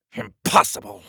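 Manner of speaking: high, sudden outburst; angry disbelief. Acted. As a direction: snap it out in disbelief. King rejects the wizard's idea.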